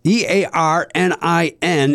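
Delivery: each letter of E A R N I N spelled slowly, then Earnin; slow letter-by-letter spelling. Shown as a demonstration. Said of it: "is pronounced Earnin".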